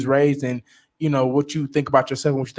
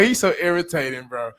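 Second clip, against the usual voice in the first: ordinary talk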